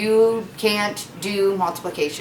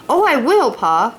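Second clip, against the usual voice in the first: put-on high, louder eager little girl; eager promise; 5 semitones higher, 5 dB louder than usual. Laura promising Pa, excited and louder. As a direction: promise it brightly like an excited kid. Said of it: "Laura promised".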